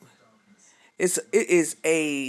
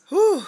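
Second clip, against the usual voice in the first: sharp high whew of emotion; relieved whew fighting tears; emotional exhale; 7 dB louder than usual. Real, not acted. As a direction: blow out the feeling to steady yourself. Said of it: "steadying herself against tears".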